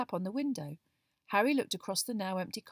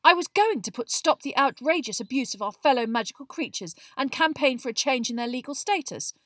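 loud, higher, indignant justification; heated Hermione; 6 semitones higher, 8 dB louder than usual. A narrator's reading. Girl defending her campaign for magical creatures, heated. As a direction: argue it hotly. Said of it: "said Hermione hotly".

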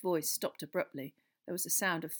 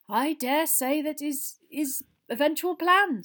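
slow, raised, hedging; evasive; 10 semitones higher, 10 dB louder than usual. A narrator's reading. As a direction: stall and dodge the question. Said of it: "said Fudge evasively".

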